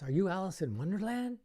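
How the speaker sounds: high, loud teasing question; a magician teasing a kid volunteer, playful and high; playful tease. Shown as a demonstration. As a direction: tease the kid with mock wonder.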